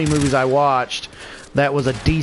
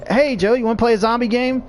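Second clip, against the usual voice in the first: higher, chirpy mock invitation; eager pestering friend; 8 semitones higher than usual. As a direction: bug your buddy over and over, higher. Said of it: "And he's like".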